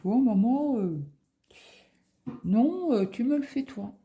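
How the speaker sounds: put-on whiny kid. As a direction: beg like a scared boy. Son begging mother to do test.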